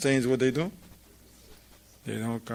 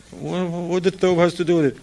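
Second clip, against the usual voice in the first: higher, fast disbelieving question; incredulous retort; 6 semitones higher, 3 dB louder, faster than usual. Retold or imitated. Teacher asking his friend why the thobe matters, fast and incredulous. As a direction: rattle off the disbelief quickly.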